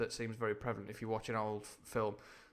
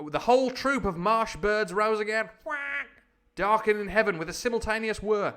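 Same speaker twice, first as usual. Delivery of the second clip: high, loud excited storytelling; swept-up adventure storyteller; dramatic narration; 10 semitones higher, 10 dB louder than usual. A narrator's reading. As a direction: narrate at full theatrical pitch.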